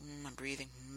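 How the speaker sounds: quiet murmured phone comfort; hushed comforting murmur; soft listening noises. Shown as a demonstration. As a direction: hum softly down the line. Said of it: "make those noises".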